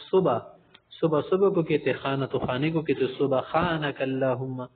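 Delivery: usual speaking voice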